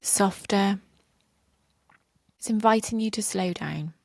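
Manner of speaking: soft spa whisper. Shown as a demonstration. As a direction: breathe the words out gently, pausing. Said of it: "guide you through a short reflection".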